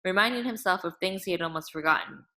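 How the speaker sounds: ordinary delivery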